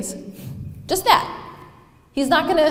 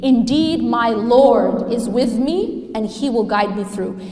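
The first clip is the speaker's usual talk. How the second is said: ordinary talk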